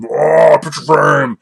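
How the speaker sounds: loud, high mock cry of a grabbing horde; frantic crowd cry. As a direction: shout it like shoppers rushing a pallet. Acting the frantic shopping horde.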